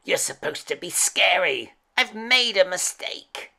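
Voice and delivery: high, dismayed storybook witch; hammed-up witch, aghast at her own creation; screeching witch. A narrator's reading. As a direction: scold in a high, exasperated witch voice. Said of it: "screeched the witch".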